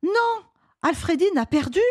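put-on high, upset little boy; high-pitched youngster in despair; dismayed child. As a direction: lament the villain's win like a kid. Boy despairs over heroine's death.

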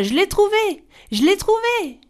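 excited exclamation. Acted. Sister calling down from the tree, thrilled. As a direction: shout it out, thrilled, from the treetop. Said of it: "la sœur s'exclama".

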